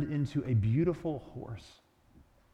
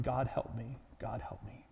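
soft whimper, fading away; 2 semitones lower, 8 dB quieter than usual. A narrator's reading. Ghost giving in to the angel, fading whimper. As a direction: whimper it quietly, falling to almost nothing. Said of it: "but ended with a whimpering".

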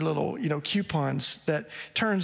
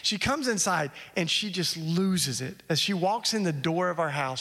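normal talking voice

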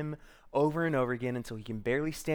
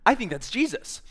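excited recognition; 8 semitones higher, 4 dB louder than usual. Acted. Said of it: "and they're like".

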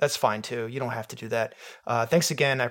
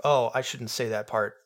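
usual speaking voice

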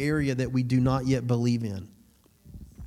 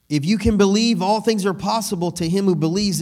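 preaching at full force; 6 semitones higher, 5 dB louder than usual. Acted. Preaching Jesus's words aloud.

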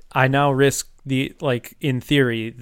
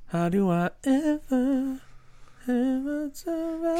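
mock dramatic wail; 13 semitones higher, 3 dB quieter, slower than usual. Mock lament about building it.

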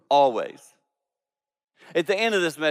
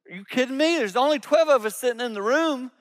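ordinary talk

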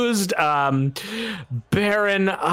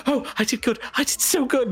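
high, delighted little boast; pleased gambler girl; 9 semitones higher, faster than usual. Acted. Girl gloating over her win, high delighted voice. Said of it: "she's like".

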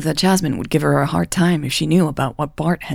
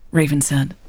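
usual speaking voice

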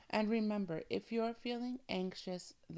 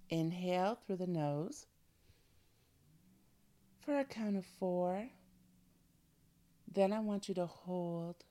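guided breathing. Acted. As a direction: calmly guide each breath. Leads breathing exercise.

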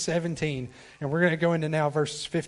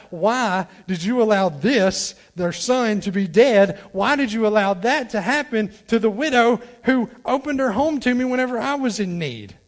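Elijah crying out; 6 semitones higher, 6 dB louder than usual. Retold or imitated. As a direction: plead with God for the widow. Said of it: "calls out to God".

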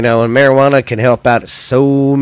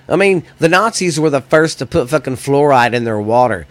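ordinary talk, nothing marked

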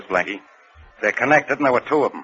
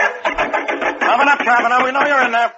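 calling through a door; 9 semitones higher, 3 dB louder than usual. Acted. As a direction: bark it through the locked door. Inspector calls through locked door.

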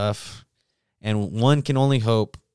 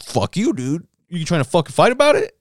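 mock aggressive; 7 semitones higher, 4 dB louder than usual. Big dude picking a fight.

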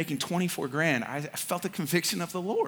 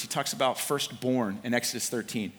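normal talking voice

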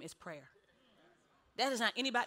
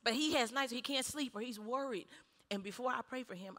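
usual speaking voice

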